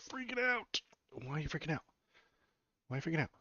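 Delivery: high coo asking the dog what's wrong; high pet talk. Real, not acted. Owner cooing at his anxious dog, high pet voice. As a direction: sing it up high like talking to a puppy.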